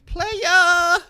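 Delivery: high shouted "Playa!" hype call. Pretend. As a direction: yell it like a proud show-off. Boasting about young chicks.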